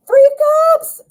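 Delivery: thrilled shout of the card; excited reveal. Real, not acted. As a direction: announce it with delight.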